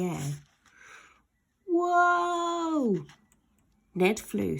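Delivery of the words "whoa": high drawn-out whoa falling away; long whoa. Acted. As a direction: cry out while flung through the air. Boy flung through air.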